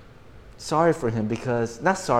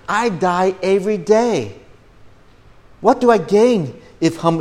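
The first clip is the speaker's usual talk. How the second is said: raised, drawn-out preacher's reading, easing down at the close; Scripture reading; 4 semitones higher, 4 dB louder than usual. Acted. Preacher reads Paul's letter to the church, declaiming. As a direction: read it out high and slow to a congregation, settling by the end.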